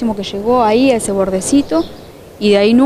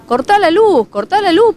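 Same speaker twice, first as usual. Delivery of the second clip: high-pitched, insistent order, slightly raised; urgent retold command; 9 semitones higher, 3 dB louder than usual. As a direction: re-enact calling out an urgent instruction.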